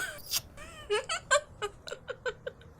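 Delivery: high, bubbling laugh of delight; giddy laugh. Acted. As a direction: laugh out happily. Giddy laugh after kiss line.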